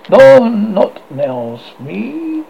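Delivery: slow, loud, darkly threatening. Acted. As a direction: drag it out darkly, rising on the name. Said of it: "Fox said darkly".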